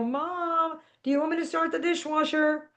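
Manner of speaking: high-pitched call held back in volume; mock yell. A narrator's reading. Teen calling up the stairs to Mom, high but softened yell. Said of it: "and I yell".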